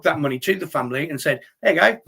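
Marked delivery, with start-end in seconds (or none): 1.6-2.0 higher and louder, hammed-up handover; mock cheerful fundraiser. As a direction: play the proud fundraiser handing over cash. Acting out handing over money.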